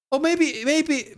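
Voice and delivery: sudden high, wondering maybe; surprised doubt. Real, not acted. Sudden doubt about the date.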